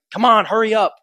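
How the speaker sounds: high, loud mock hurry-up; mock impatience. Impatient phone user demanding speed, hammed-up and loud. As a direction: playfully ham up the impatience.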